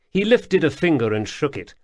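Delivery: plain reading voice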